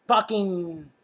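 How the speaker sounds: ordinary talk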